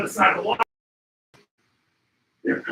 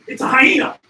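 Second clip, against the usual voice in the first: louder, alarmed call of the name; startled exclamation; 12 dB louder than usual. Real, not acted. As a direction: blurt out the warning. Spotting the hyena.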